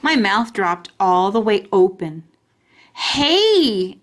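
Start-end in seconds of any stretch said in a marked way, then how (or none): 2.9-4.0 put-on a child's holler from under a dress. A narrator's reading. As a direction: call out loud and high. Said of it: "I hollered from under my dress".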